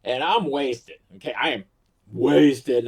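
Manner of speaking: ordinary talk, nothing marked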